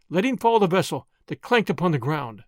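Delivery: ordinary delivery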